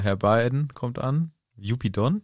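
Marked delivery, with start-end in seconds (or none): 1.6-2.2 "Jobi Don" run together as one odd foreign name; puzzled, softer "Jobi Don" with a questioning rise; mispronounced name. Real, not acted. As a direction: say "Jobi Don" quietly, stress up front, unsure. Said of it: "so spricht man den aus".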